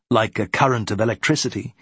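ordinary delivery

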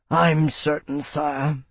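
rumbling. Acted. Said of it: "Bernard rumbled".

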